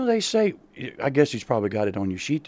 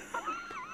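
high laugh; 16 dB quieter than usual. Real, not acted. Co-host's joke.

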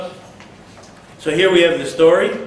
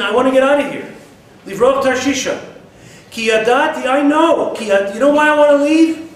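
Yonah protesting; 6 semitones higher than usual. Acted.

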